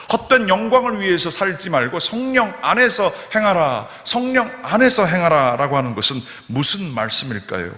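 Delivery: loud, high-pitched preaching to the congregation; full-force preaching. Acted. Pastor urging the congregation, loud fervent preaching. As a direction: preach it out with fervor.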